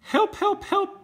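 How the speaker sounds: very high, loud calls for help; squeaky cry for help. Acted. Little truck stuck in the mud, crying high for help.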